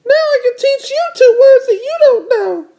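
very high, gleeful little-sister voice. Acted. Little sister teasing her brother, very high gloating. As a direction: gloat in a squeaky cartoon kid voice. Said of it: "said D W".

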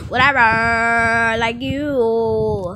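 slow, loud, scornful whatever; loud drawn-out whatever. Acted. Kid hurls a slow loud whatever at mom. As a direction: stretch it out in loud contempt.

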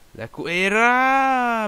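long drawn-out high shout of the name; excited commentary shout. Real, not acted. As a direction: call the name out like a goal chance. Attacker shoots, commentator cries name.

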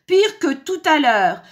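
loud, high outburst of disgust; yelled complaint. A narrator's reading. Wolf complaining about worse soup, loud disgusted yell. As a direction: complain loudly at the taste. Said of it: "hurla-t-il".

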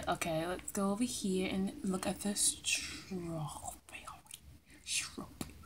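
hushed unvoiced whisper; sneaky whisper. Real, not acted. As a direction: whisper so nobody hears. Sneaking past in game.

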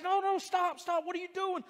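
high, loud, urgent pleading; alarmed shout. Retold or imitated. Butcher begs the angry man to stop, high shout.

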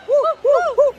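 high, loud repeated whoops; celebratory whooping. Real, not acted. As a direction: whoop in short joyful bursts. Celebrating the win. (gritos de júbilo, uh uh)

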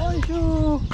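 very high, lilting "Bonjour"; sing-song greeting. Real, not acted. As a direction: toss a chirpy hello to a passerby. Greeting a passerby.

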